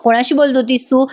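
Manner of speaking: ordinary talk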